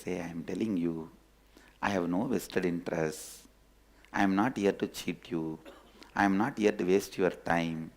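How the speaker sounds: low, soft and earnest; quiet, low-pitched promise to a wary friend; settled, sincere reassurance. Acted. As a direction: reassure gently, voice dropped low. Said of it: "slowly his nervousness settles down".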